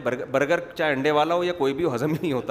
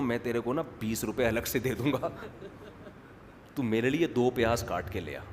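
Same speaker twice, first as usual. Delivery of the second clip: coaxing aside; 5 semitones lower, 7 dB quieter than usual. Retold or imitated. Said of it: "اس کو بولتا ہوں".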